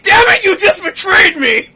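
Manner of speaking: high, loud, hammed-up betrayal; mock outraged shout. Man looking down at himself, betrayed, mock outraged shout. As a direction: yell it downward in mock dismay. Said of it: "I'm looking down like".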